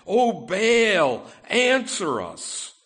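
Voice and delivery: loud, drawn-out plea to a silent god; crying out to Baal. Acted. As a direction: call out to Baal as a desperate worshipper.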